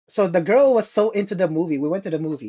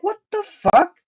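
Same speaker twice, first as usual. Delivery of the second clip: shocked; 14 semitones higher, 3 dB louder than usual. Retold or imitated. Retelling waking up shocked.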